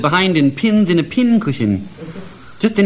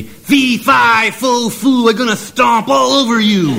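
high, loud shouting giant chant; giant roar; 7 semitones higher, 3 dB louder, slower than usual. Acted. Uglified giant roaring at Stupid Jack, loud high chant. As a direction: bellow it like a giant catching a thief.

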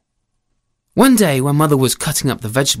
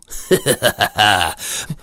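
bleating staccato goat cackle; evil goat laugh; 6 semitones lower than usual. Acted. Goat laughs after its threat.

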